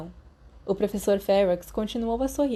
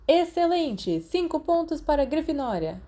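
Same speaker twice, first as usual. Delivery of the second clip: bright, higher cheer of approval; delighted praise; 7 semitones higher than usual. Acted. Professor praising a pupil, lightly voiced delight. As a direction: reward the pupil with gusto.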